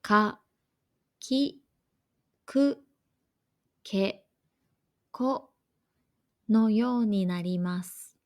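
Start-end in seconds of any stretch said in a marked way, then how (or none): 0.0-5.5 か、き、く、け、こ each said alone, short and clear; k-row syllables. Shown as a demonstration. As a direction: spell out か き く け こ one by one with pauses. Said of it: "例えば".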